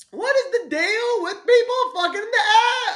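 high, loud caricature of a stand-up; Seinfeld. Acted. Host attempting Seinfeld for the co-host, exaggerated failing impression. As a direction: push the question up into a hammed-up squeal. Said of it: "let me try that Seinfeld".

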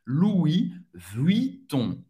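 Louis Vuitton slowly, silent S, French U; Louis Vuitton devagar, à francesa. Shown as a demonstration. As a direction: spell out Louis Vuitton syllable by syllable. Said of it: "não vai pronunciar o S".

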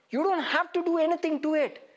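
ordinary talk, nothing marked

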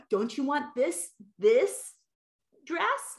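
put-on rising pleading questions to a toddler; coaxing parent. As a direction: coax a stubborn child, voice climbing. Parent coaxing toddler about dress.

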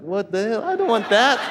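high, rising indignant objection; playfully outraged, pitch climbing; mock protest. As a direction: protest like a comically offended churchgoer. Acted protest at unwelcome prophecy.

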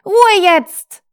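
desperate shriek to shut them up; screaming. Acted. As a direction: scream until her face goes red. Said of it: "schrie Karen erneut".

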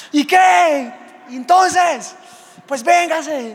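high, loud challenge yelled at the robber; mock street shout. Pastor retells daring the mugger, shouting high and loud. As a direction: hurl a cocky dare at the mugger.

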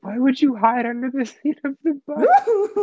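high, laughing through the words; laughing question. Real, not acted. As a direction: ask it while giggling. Laughing at absurd story.

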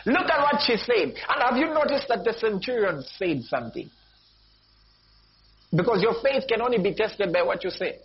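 high-pitched, insistent preacher; urgent pulpit exhortation; emphatic preaching. Real, not acted. Preacher presses the point home.

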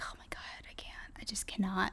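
whispering. Retold or imitated. Dramatizing her own reluctance to drink.

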